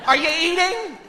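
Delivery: high, fretful mom voice; nagging mother. Acted. Mom checking that son eats, nagging. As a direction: badger your son in a high voice. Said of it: "makes me feel like I'm stupid".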